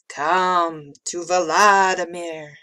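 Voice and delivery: slow, loud, drawn-out summons; icy monster. A narrator's reading. Vladimir beckoning Emma from under the bed, icy menace. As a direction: summon the child slowly in a spooky voice. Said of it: "the monster continued".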